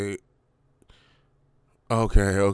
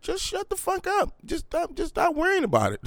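high, scornful squeaky inner voice; a mock exasperated squeal in his head. In his head to depressed friends, high mocking squeal. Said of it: "I'm like".

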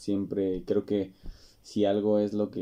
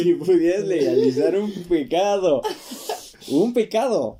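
laughing; 10 semitones higher, 8 dB louder than usual. Real, not acted. Laughing, repeating the shocking question.

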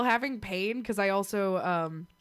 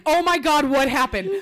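loud, raised mock-panicked cry; mock alarm, pitched up; imitated mom panic; 4 semitones higher, 6 dB louder than usual. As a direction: imitate a mother freaking out. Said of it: "the panic in her voice was unreal".

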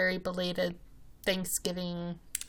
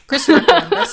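high, loud laughing outburst; laughing exclamation; 9 semitones higher, 15 dB louder, faster than usual. Real, not acted. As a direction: blurt it through a laugh. Correcting herself, laughing.

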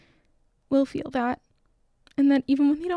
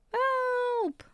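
storybook cry for help; 12 semitones higher, 4 dB quieter than usual. A narrator's reading. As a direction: call out for rescue in a small high voice. Squirrel falls into rushing river.

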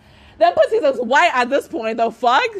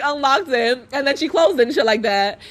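ordinary talk

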